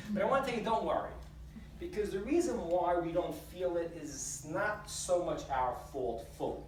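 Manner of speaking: usual speaking voice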